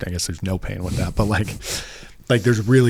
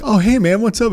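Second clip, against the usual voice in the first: bright, much higher, louder "hey man"; casual friendly greeting; 12 semitones higher, 5 dB louder than usual. Retold or imitated. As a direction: imitate a relaxed friend picking up the call. Friend answers phone at dinner.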